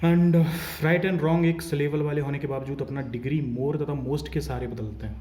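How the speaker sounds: loud, pressing classroom rule; emphatic teacher. Real, not acted. Teacher announcing a rule to students, loud and emphatic. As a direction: drive the rule home to the class. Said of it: "teacher stresses rule loudly".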